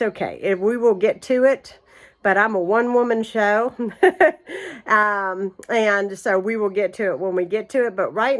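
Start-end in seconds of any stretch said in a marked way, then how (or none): 3.8-4.8 bright little laugh; short laugh. Real, not acted. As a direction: laugh off the joke. Laughs after one-woman show.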